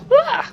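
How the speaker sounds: a sudden piercing high shriek; womanly shriek. Shown as a demonstration. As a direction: squeal as if startled by a friend. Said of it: "and I would do the".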